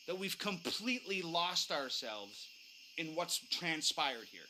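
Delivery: usual speaking voice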